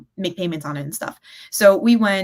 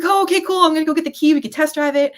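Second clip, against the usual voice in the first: high, loud imitation of an eager salesman; bubbling over, voice leaping up; giddy and excited; 10 semitones higher, 7 dB louder, faster than usual. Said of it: "the guy was all giddy and excited".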